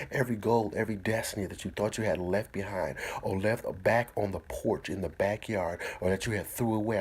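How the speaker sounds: normal talking voice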